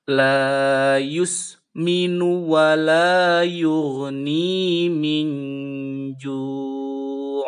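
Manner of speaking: slow loud chant with faint hummed end; slow tajwid chant; Quran recitation. Shown as a demonstration. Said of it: "Contohnya seperti ini".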